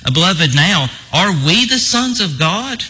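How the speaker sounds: "are we the sons of God" lifted high like a question; read as a question. Retold or imitated. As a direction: slowly raise "are we the sons of God" into a puzzled query. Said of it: "sounds like a question to me".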